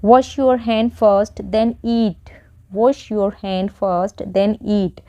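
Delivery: very slow, word by word; slow teaching read. Shown as a demonstration. Teacher slowly models an order for students. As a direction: lay each word out slowly. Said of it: "next है".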